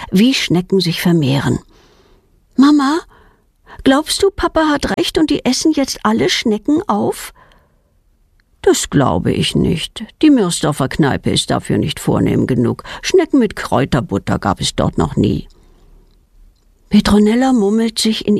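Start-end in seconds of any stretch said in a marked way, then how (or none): 2.6-7.3 put-on high little-girl voice asking her mother; questioning child. A narrator's reading. Kind fragt Mutter am Bett. 8.6-15.5 low-pitched mother answering at bedtime; reassuring mother. A narrator's reading. Mutter antwortet dem Kind.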